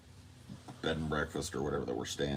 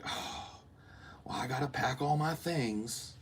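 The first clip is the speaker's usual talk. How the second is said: airy, higher mock-annoyed mother; annoyed mom; 8 semitones higher, 3 dB quieter than usual. Mom refusing to hurry and packing her things, mock annoyed. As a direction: imitate an unbothered, annoyed mom. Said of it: "She was just annoyed".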